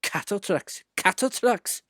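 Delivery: high, rhythmic grumble; grumbling coaches. A narrator's reading. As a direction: chant it resentfully like rolling wheels. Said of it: "grumbled the coaches".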